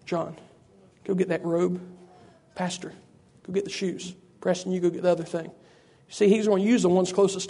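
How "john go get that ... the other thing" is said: hushed instructions. Acted. Preacher acting the father addressing men close by, hushed instructions. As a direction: quietly send those right beside you. Father quietly sends those nearest him.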